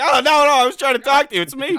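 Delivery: high, loud panicked protest; mock pleading. Voice actor shot while trying to talk, mock-panicked pleading. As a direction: imitate frantic pleading, hammed-up.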